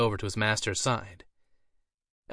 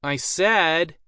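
raised, insistent repeat; louder voice; 6 semitones higher, 6 dB louder than usual. A narrator's reading. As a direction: say it louder so they finally listen. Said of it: "he spoke in a louder voice".

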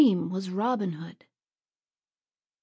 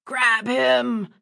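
loud, higher order to seize him; urgent cry; 4 semitones higher, 6 dB louder than usual. Acted. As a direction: bark it at the others. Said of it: "cried a third man".

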